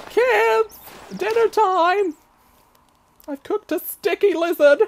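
high, loud mock mum calling out; mother calling kids. Pretending to be a mother calling kids to dinner, high and loud. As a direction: call the kids in like a hammed-up mum. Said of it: "I could be like".

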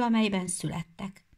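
usual speaking voice

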